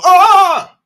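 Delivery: sudden high loud scream; wife's startled shriek. Retold or imitated. Wife shrieks at the skateboard, high startled scream. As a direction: shriek in alarm at the mess. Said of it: "I hear my wife go".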